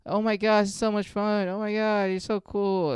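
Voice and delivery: high-pitched mock gushing; fake party energy. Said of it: "just fake energy".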